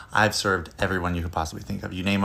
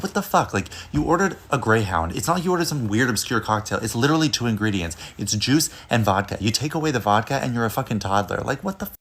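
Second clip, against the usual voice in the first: incredulous, louder and higher outburst; ranting in disbelief to the rapper; exasperated rant; 3 semitones higher, 4 dB louder than usual. Retold or imitated. Retold outraged inner rant.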